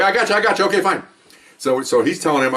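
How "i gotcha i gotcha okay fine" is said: higher, hurried acted reply; acted helicopter voice, pitched up; radio acknowledgement.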